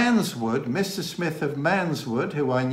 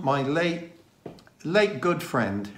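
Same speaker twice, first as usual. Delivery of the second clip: ordinary talk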